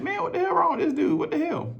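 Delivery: high, laughing disbelief; mock a buddy's baffled laugh; mocking bro voice. Bros laughing at him, high mocking disbelief. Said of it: "bros laughed at me like".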